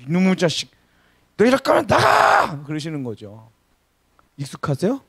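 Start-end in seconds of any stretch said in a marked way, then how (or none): 0.1-2.6 shouted scolding, voice jumping high; angry mother yelling. Retold or imitated. Mother scolding her late son with a shout. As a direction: yell like a furious mom at a late kid. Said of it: "그래서 하시는 말씀이".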